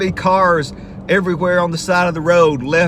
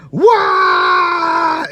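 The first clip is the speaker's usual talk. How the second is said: very high, loud hyped whoop; the media as rowdy bus kids, yelling; wild yell; 8 dB louder than usual. Retold or imitated. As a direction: scream like the media gone wild. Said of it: "screaming and doing flips".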